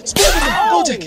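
loud breathy exclaim; excited shout. Real, not acted. Player spotting Spider-Man, excited breathy shout. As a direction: blurt the name out in thrill. Sees a character.

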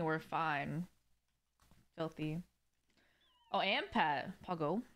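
ordinary talk

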